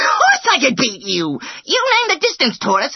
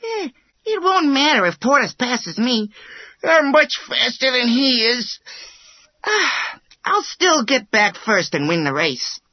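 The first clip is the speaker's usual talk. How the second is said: sleepy morning after; 14 semitones higher than usual. A narrator's reading. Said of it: "he murmured sleepily".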